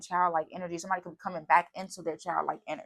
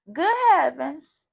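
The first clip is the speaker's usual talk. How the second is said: higher, louder and slow, stretched out; mock astonished; 10 semitones higher, 8 dB louder, slower than usual. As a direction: ham up the astonishment, drawn out. Repeats phrase, second one hammed.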